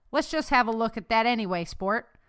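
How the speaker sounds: ordinary delivery, nothing marked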